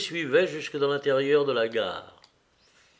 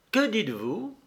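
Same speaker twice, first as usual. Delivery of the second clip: exclamation surprise, plus aiguë; un sursaut de surprise à peine marqué; s'écrier, surpris; 7 semitones higher than usual. A narrator's reading. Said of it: "s'écria-t-il". (Oblonsky apprend que Vronsky part, surpris)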